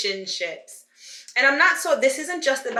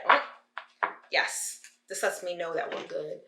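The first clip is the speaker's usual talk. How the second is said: ordinary talk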